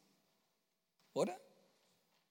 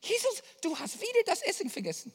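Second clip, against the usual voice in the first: much higher, fast nagging complaint; mock nagging; 12 semitones higher, 5 dB louder, faster than usual. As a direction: whine it like a nagging companion. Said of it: "was hätten wir gesagt?". (Jünger nörgelt über vergessenes Essen, hoch und schnell)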